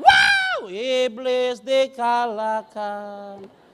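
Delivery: a demon-possessed shriek; possessed scream. Retold or imitated. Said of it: "teriak dia".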